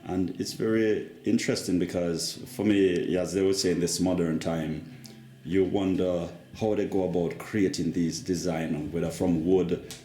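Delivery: usual speaking voice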